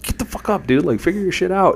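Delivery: high-pitched, exasperated mock yell; yelling at friend. Friend orders collapsing buddy to get up, high mock yelling. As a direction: imitate snapping at a useless buddy. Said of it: "I'm yelling at my friend".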